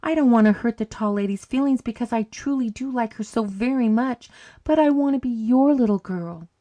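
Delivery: put-on little girl pleading earnestly; begging. A narrator's reading. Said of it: "she begged".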